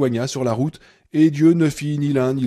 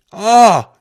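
a sharp, much louder, higher furious cry; howled cry; 7 semitones higher, 10 dB louder than usual. A narrator's reading. Annie screaming as she strikes Paul's knee. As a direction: scream as the fist comes down. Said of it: "hurla-t-elle".

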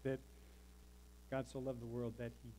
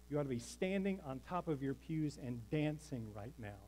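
usual speaking voice

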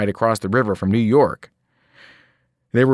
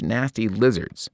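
ordinary delivery, nothing marked

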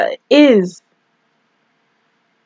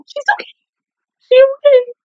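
very high, strained self-reassurance; tiny high voice clinging to hope; squeaky denial; faster than usual. Real, not acted. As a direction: squeak it out, desperately convincing yourself. Upset viewer reassuring self about character.

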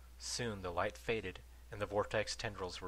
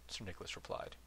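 plain reading voice

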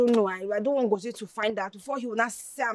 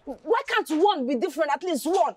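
heated higher-pitched venting; pissed off; 5 semitones higher than usual. Acted. Woman venting to her friend about men, pissed off. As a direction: vent in exasperation, voice riding high. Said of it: "I am so pissed".

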